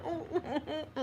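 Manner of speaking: high, soft whimpering wails; mock crying. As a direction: imitate a wee old lady crying. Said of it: "like that, crying".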